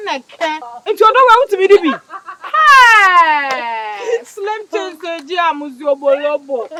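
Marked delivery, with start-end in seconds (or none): 2.5-4.2 sudden loud call of Hey!; shout. Acted. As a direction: yell it out to stop them.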